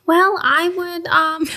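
high, slow put-on voice; mock interviewee. Friend playing the guest answering the cheesy interviewer, high and hammy. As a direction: pitch it high and draw it out, playing along.

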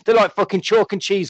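ordinary talk

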